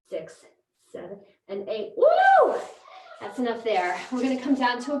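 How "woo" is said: high, loud celebratory whoop; excited whoop. Real, not acted. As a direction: let out a joyful woo. Coach cheers after the set.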